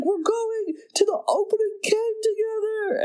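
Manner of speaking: high-pitched, quavering impression of an old film star; Jimmy Stewart. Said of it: "He's doing a Jimmy Stewart impression".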